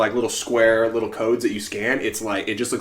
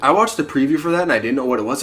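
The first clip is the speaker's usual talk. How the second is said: usual speaking voice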